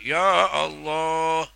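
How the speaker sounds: high, loud, slow outcry; cry again. Shown as a demonstration. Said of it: "teriak lagi". (teriakan keras sekali lagi)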